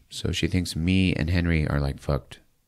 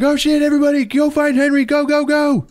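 high, loud frantic yelling; rally everyone in a panic; panicked shout; 5 dB louder than usual. Retold or imitated. Family yelling at everyone to find Henry, panicked shout.

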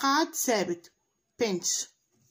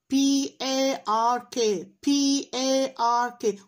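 spelling aloud; much slower than usual. Shown as a demonstration. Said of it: "تقراها بصوت عالي".

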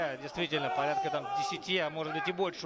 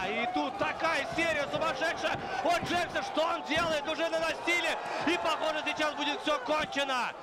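rising excited play-by-play, much higher; fired-up commentator calling a knockout flurry; excited commentary; 9 semitones higher than usual. Real, not acted. Commentator erupts at flurry of strikes.

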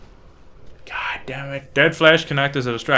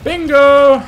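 excited; 11 semitones higher, 9 dB louder than usual. Real, not acted. Excited gamer finds item.

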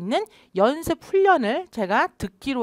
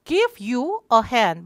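drawn-out lead line for the class to repeat; slow choral lead; 3 dB louder, much slower than usual. Shown as a demonstration. Teacher leading class repetition, slow and drawn out. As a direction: say it slowly together. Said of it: "다 같이".

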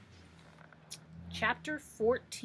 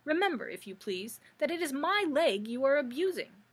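sharp rebuke; 5 semitones higher, 5 dB louder than usual. A narrator's reading. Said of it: "said the Pumpkinhead sharply".

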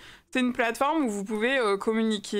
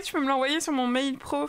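ordinary talk

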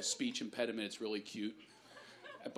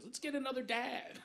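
put-on high sweet kid voice; little girl voice; 12 semitones higher, 5 dB quieter than usual. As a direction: chirp it like a five-year-old. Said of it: "My daughter goes".

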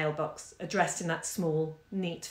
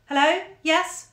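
high, loud telephone greeting; bright-pitched questioning hello; new voice on phone; 9 semitones higher, 6 dB louder, slower than usual. A narrator's reading. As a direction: answer the phone, voice lifted high. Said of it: "and then a new voice said".

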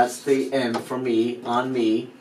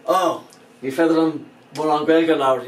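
rattled off quickly and a little louder; said fast; 4 semitones higher, 6 dB louder than usual. Shown as a demonstration. Teacher mimicking a fast native Irish speaker, quick and a bit louder. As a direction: rush it like a native speaker would. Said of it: "if they say something fast".

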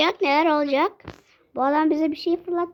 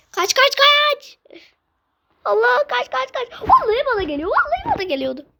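panicked; 9 semitones higher than usual. Real, not acted. Fleeing danger in game.

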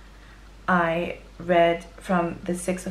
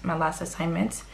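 ordinary talk, nothing marked

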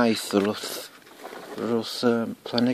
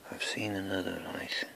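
whispering; 11 dB quieter than usual. Real, not acted. Whispering while searching.

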